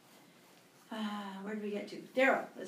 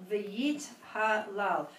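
slow, careful model reading; much slower than usual. Shown as a demonstration. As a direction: read it slowly for a learner.

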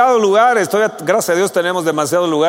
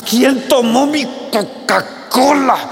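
slow, higher, grumbling demand for the Coca-Cola; mock angry demand; 5 semitones higher, slower than usual. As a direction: play the grumpy man who lost his soda. Imitating angry man at fridge. (exigencia enojada, lenta y algo más aguda)

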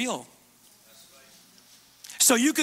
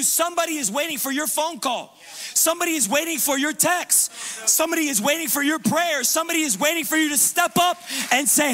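preaching at full force; 5 semitones higher than usual. Acted. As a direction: drive it home like a revival preacher.

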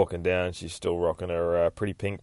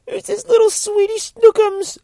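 very high, loud cooing falsetto; syrupy high pet voice; baby talk to a pet; 9 dB louder than usual. Acted. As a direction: gush over a cute animal in a squeaky voice.

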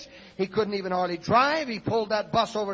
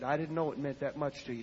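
tearful, softened; 6 semitones lower, 6 dB quieter than usual. Retold or imitated. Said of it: "tears started streaming down his face".

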